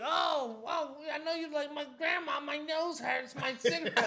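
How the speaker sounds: high-pitched mock take-off; hammed-up high voice, poking fun; grandma mimicking grandson. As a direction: mimic someone in a silly high voice. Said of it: "she mimics me the same way".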